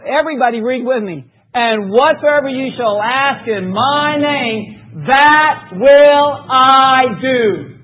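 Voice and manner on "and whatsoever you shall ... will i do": very slow, drawn out, will loud; slow emphatic reading. Acted. Preacher leading the church through the verse, slow and emphatic. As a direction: stretch it, lean hard on will. Congregation reads verse together.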